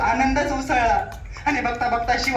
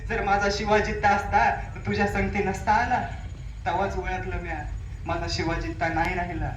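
hushed, holding back tears; grieving softly; 5 semitones lower, 6 dB quieter than usual. Acted. Grieving mother tells the king her son is gone, hushed. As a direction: speak the loss barely above a breath.

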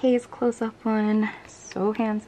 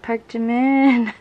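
slow and louder, savouring the name; excited gush; 2 semitones higher, 8 dB louder, slower than usual. Real, not acted. Fan gushing loudly over a [name] card. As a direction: announce him like a prize.

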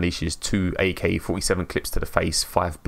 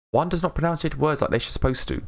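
higher mock-lofty pronouncement; 8 semitones higher than usual. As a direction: declare it with mock grandeur. Mocking own speech, mock-lofty.

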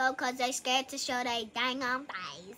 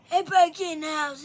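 quicker, raised grandpa call; old man voice; 2 semitones higher, 7 dB louder, faster than usual. Shown as a demonstration. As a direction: yell it like a cranky old man. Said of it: "that's good old man voice".

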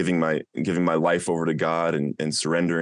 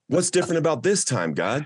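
high, anguished demand; angry cry to God; 7 semitones higher than usual. Retold or imitated. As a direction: yell the question up at God. Screaming at God in car.

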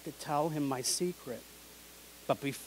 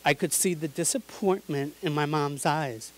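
ordinary delivery, nothing marked

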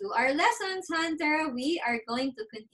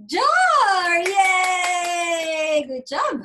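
loud, high cheering; excited praise; 5 semitones higher, 6 dB louder, much slower than usual. Real, not acted. Praising child's right answer.

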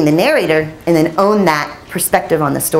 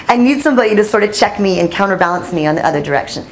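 normal talking voice

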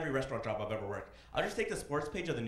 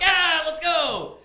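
very high, very loud slow whoop; hammed-up victory yell; mock cheer; 13 semitones higher, 13 dB louder, much slower than usual. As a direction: cheer like a hyped bro. Guys cheering about pills.

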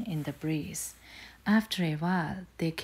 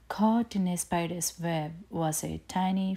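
plain reading voice

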